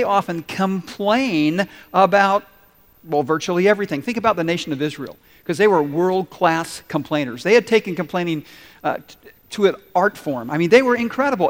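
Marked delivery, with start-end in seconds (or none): none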